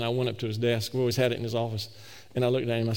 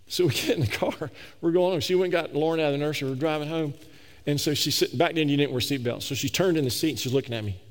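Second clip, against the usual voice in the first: ordinary talk, nothing marked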